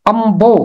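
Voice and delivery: अम्बो with the न sounding as म before ब; teacher demonstrating अम्बो; nasal becomes m. Shown as a demonstration. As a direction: say अम्बो as am-bo, the n turned to m. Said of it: "इसलिए इसे पढ़ते हैं".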